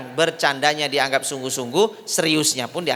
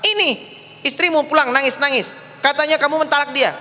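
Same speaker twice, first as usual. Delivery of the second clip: high, louder accusing report; indignant mother-in-law; 10 semitones higher, 4 dB louder than usual. Acted. Mother telling son-in-law his wife came crying, indignant. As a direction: press the accusation, voice raised.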